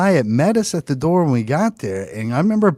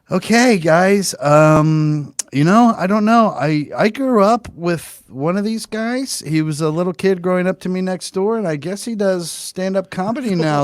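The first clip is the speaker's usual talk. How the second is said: nervous neighbor; 6 semitones higher than usual. Retold or imitated. Neighbor introduces the comic on stage, high and nervous. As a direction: stumble through it nervously. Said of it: "he's all nervous and shit".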